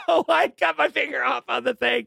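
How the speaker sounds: put-on high, loud, giggling blurt of a guilty kid; laughing confession. Boy owning up to his dad at dinner, high laughing blurt.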